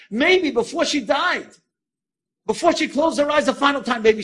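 loud, high, fervent climax; impassioned preaching. Real, not acted. Rabbi's thought on her last moments, impassioned.

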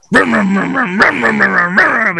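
vroom vroom like a chainsaw; mock chainsaw revving; chainsaw noise. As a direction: buzz like a revving saw. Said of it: "a chainsaw".

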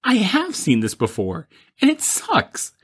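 dismay turning into a disgusted squeal; disappointed. Retold or imitated. Recognizing the bad movie on TV, disgusted whine. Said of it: "and I'm like".

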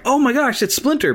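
high, thrilled squeal of recognition; excited recognition. Retold or imitated. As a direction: gush like spotting a childhood hero. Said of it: "I would have been like".

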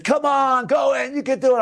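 high, eager coaxing; urging cheer. Retold or imitated. Friend coaxing him into the cold mikveh, high eager urging. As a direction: egg your friend on to jump.

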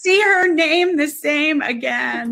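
laughing until near tears. Real, not acted. Reacts to a funny story.

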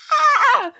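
high, loud cry through laughter; comic sobbing shriek; mock grief wail. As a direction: let out a hammed-up wail of grief. Acting out renewed grief at film.

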